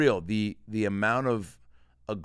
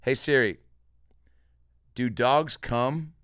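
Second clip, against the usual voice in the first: slow, over-enunciated voice command; slow clear command; 4 semitones higher, 6 dB louder, slower than usual. Shown as a demonstration. Comedian slowly dictating a question to Siri, over-enunciated. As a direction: spell it out slowly so the phone understands. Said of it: "here we go. Ready?".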